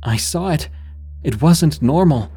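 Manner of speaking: high-pitched, emphatic insistence; shot back. A narrator's reading. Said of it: "I shot back".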